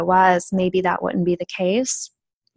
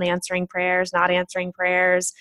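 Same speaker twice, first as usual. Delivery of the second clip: usual speaking voice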